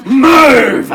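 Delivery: high, very loud bellow; explosive shouted order. Acted. Doctor to nurse who thinks he's joking, explosive shout. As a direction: let one word explode as a command.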